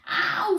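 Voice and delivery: high yelp of "ow"; high pained squeal. A narrator's reading. Vain pupil has hair pulled, squealing. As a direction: squeal in pain. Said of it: "squealed Vain Violet".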